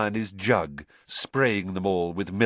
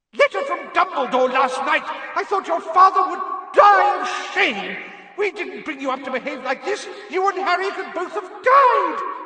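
furious mother screaming, much higher and louder, slowed; Mrs Weasley's howler yell; 6 dB louder than usual. A narrator's reading. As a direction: bellow like an enraged mum filling a hall. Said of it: "Missus Weasley's yells".

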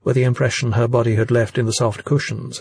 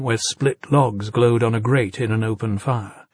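usual speaking voice

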